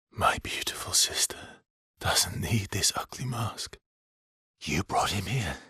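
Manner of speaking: hushed, tender whisper; soft whisper. Acted. Speaking softly about a beloved sister, whispered. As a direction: breathe it out gently to a loved one.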